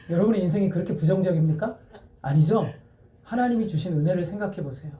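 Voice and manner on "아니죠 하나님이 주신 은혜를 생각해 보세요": ordinary delivery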